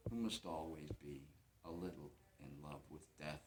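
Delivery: low, soft, fading away; quiet confession. Acted. A stranger a little in love with death, quiet confession. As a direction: sink into a hushed admission.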